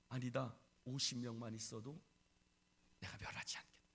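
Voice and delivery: low, soft divine answer; hushed reply. Acted. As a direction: drop low and quiet, fading out. God answers Abraham, acted. (낮고 조용한 대답)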